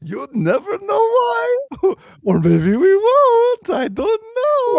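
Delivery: high, slow, laughing villain voice; vaudeville villain. Pretend. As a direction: ham it up like a stage villain. Vaudeville Trump bit continued.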